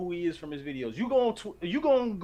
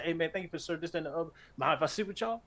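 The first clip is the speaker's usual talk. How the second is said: quick, softer, casual greeting; fast friendly celebrity voice; 3 semitones lower, 5 dB quieter, faster than usual. Retold or imitated. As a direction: breeze through it friendly. Bill Bellamy quoted.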